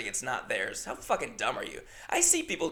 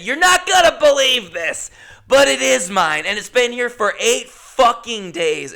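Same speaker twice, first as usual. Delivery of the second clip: much higher and louder, furious; angry swearing; 8 semitones higher, 9 dB louder than usual. Retold or imitated. Telling the airline worker it's his bag: furious outburst. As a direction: snap back at the airline worker. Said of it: "And I don't swear".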